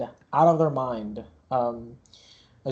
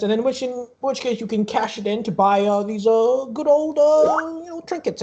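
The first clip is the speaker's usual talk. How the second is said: higher-pitched, chatty fairground showman; carnival barker; 9 semitones higher than usual. Acted. Barker explaining tickets and trinkets to players, showy patter.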